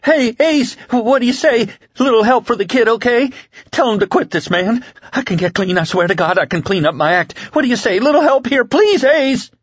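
high, desperate pleading; frantic babbling. A narrator's reading. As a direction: babble and beg in a high, panicked voice. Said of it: "Hector was babbling".

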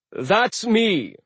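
loud, high cheerful reply; cheerful giant. Acted. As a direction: claims his tofu. Aegir answers.

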